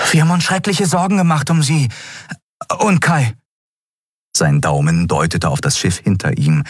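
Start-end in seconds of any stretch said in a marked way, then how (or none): 0.1-3.4 put-on very high, fast boyish voice; young Miko, eager. A narrator's reading. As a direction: rush the caring question out. Boy Miko speaks worriedly. (hohe Jungenstimme, schnell und besorgt)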